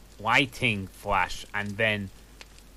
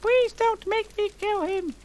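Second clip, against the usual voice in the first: much higher pleading cry; begging; 4 dB louder, slower than usual. A narrator's reading. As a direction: plead desperately in a high voice. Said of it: "I begged".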